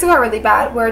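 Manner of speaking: ordinary talk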